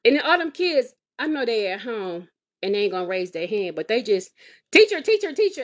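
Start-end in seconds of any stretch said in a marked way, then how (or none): 4.7-5.6 put-on high, loud kid calling teacher. Mother imitating kids shouting for the teacher. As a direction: call out like eager kids on a video call.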